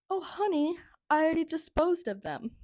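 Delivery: high, soothing imitation of an assistant; sympathetic assistant. Assistant breaking news to patient.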